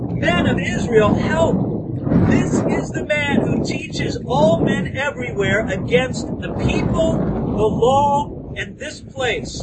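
loud, urgent shout to the crowd; crying out. Acted. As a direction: raise the alarm at full voice. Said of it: "They were crying out".

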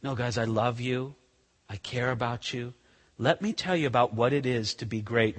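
Jesus softly reassuring his quarreling friends; tender Jesus. Retold or imitated. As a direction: gently comfort the disciples. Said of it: "Instead, he says".

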